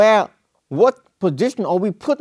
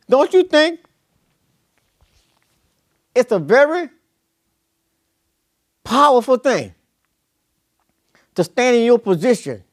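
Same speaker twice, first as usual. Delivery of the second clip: higher, louder preacher's climb; fervent preaching; 7 semitones higher, 3 dB louder than usual. Acted. Preacher challenging Korah's men, rising fervent preaching. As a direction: build it up to a shout of conviction.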